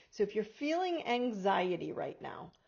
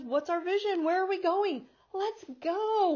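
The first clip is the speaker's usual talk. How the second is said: higher, louder, eager rallying cry; eager horse; 9 semitones higher, 6 dB louder than usual. Acted. The horse energy urging us on, eager and louder. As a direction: push everyone forward, all go.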